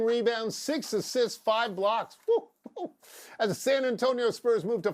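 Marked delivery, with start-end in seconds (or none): 2.3-2.9 mock cheer. Cheering a stat line.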